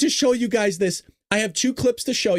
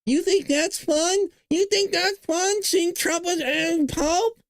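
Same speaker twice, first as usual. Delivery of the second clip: much higher, slow incredulous whine; high, drawn-out mock disbelief; mock outraged critic; 10 semitones higher, slower than usual. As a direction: parody a pearl-clutching critic. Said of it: "Yeah, I, I do".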